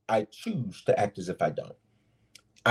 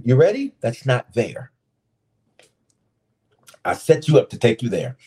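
normal talking voice

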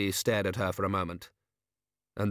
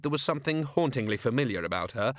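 plain reading voice

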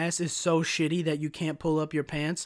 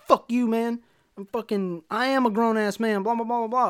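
high-pitched mock of an angry customer; whiny retold tantrum, pitched up; mocking imitation; 5 semitones higher than usual. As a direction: mimic his outburst in a higher voice.

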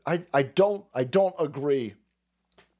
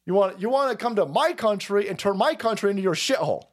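raised-pitch angry tirade; angry rant; 7 semitones higher than usual. Acted. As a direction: rant at them, higher and hot. Acting out a racist's rant reply.

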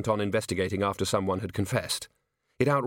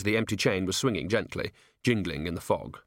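usual speaking voice

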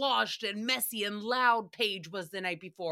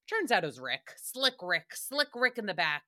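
ordinary talk, nothing marked

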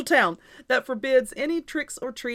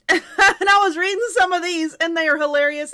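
high, loud, laughing through the words; 6 semitones higher, 9 dB louder than usual. Real, not acted. Host telling listeners about hilarious laws, laughing. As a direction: talk through a real laugh.

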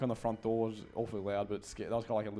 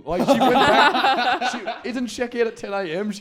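loud, high-pitched disbelief; incredulous outburst; 11 semitones higher, 12 dB louder than usual. Real, not acted. Podcaster arguing the booking makes no sense, loud incredulity. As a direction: protest loudly over the laughter, pitch way up.

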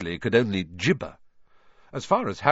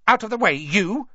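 brusque, louder bully voice; rude bully voice; 11 semitones higher, 4 dB louder than usual. A narrator's reading. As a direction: shove past with a bark. Dudley shoves Harry aside.

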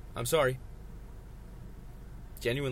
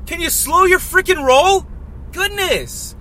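much higher and much louder, real yelling; furious shout; 9 dB louder than usual. Real, not acted. Driver yelling at a honking car behind him, furious shout. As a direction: scream your outrage at the car behind.

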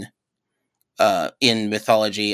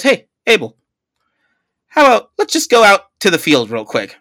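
higher, louder, mock-innocent invite; sly Cain; 8 semitones higher, 6 dB louder than usual. Cain luring brother Abel outside, mock-innocent and louder. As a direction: lure your brother out, sweetly sly. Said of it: "to his brother goes".

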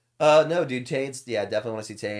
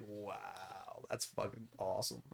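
subdued wow; 3 semitones lower, 14 dB quieter, slower than usual. Retold or imitated. Retold reaction to watching own clip.

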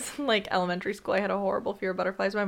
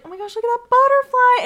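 high, loud mock gush; a sweet girl cooing at a butterfly; delighted; 9 dB louder than usual. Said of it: "And I was like".

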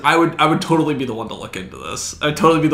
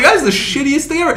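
much higher, louder indignant rant; loud high exasperated retelling; exasperated outburst; 13 semitones higher, 7 dB louder than usual. Retold or imitated. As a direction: vent at the screen in disbelief. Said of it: "I was like".

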